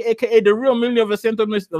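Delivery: put-on high-pitched Nigerian accent. Streamer stumbling through his last nickname, Nigerian accent. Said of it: "I'll do it in my Nigerian accent".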